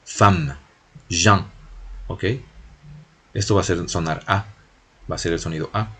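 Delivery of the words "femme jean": "Femme" con A abierta, "Jean" con vocal nasal; pronunciación francesa. Shown as a demonstration. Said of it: "esto se pronuncia solo en él". (ejemplo del sonido A en francés)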